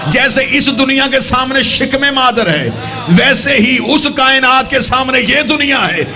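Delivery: high, ringing sermon voice building to a climax; full-force preaching. Real, not acted. Preacher likening death to birth for congregation, full-force preaching.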